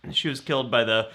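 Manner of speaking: ordinary talk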